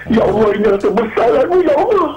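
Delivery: choked-up plea to God; tearful prayer. Retold or imitated. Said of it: "Saya menangis".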